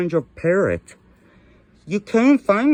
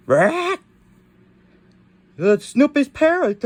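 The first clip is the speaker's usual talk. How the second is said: surprised high exclaim; 8 semitones higher than usual. Acted. Dad character recognises parrot.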